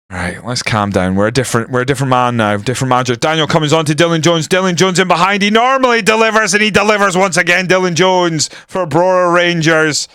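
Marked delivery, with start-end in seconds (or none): 3.1-10.1 higher, louder, building commentator call; mock match commentary rising to the finish; live play-by-play. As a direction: call the goal like it's on TV. Calls the goal like a commentator.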